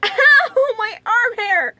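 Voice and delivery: the sting catches her mid-laugh; real pain yelp. Real, not acted. Ripping the tape off her arm hair, laughing yelp of pain.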